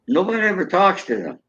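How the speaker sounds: ordinary talk, nothing marked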